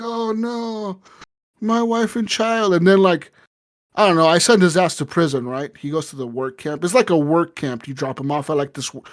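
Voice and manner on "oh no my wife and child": high, slow hammed-up wail; mock despair. Handcuffed prisoner lamenting his family, high slow mock wail. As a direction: wail it up high and drawn out, half-joking.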